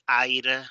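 "aire" with Spanish ai and tapped r; read as in Spanish. Shown as a demonstration. Teacher showing learners' reading, Spanish-style pronunciation. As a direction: say "aire" as Spanish spelling reads. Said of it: "como se lee en español".

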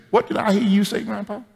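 put-on high, surprised little girl asking; small child, high and searching. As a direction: sound like a five-year-old catching you out. Little girl voiced in story.

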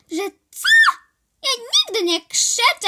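high, loud indignant denial; indignant shout. Acted. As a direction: shout that you never shout.

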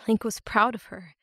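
ordinary delivery, nothing marked

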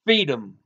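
"Feed 'em" for freedom, the r dropped; child's mispronunciation. A narrator's reading. Little girl answering the policeman, mispronouncing freedom as "Feed 'em". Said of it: "She couldn't even pronounce it".

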